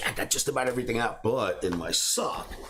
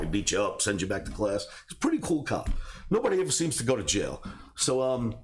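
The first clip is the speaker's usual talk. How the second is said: ordinary talk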